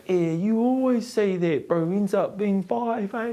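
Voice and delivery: put-on high, slow cheeky kid voice; young actor James grumbling at the director, high and slow; kid's voice. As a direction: pitch way up like a tired eight-year-old. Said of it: "James was going".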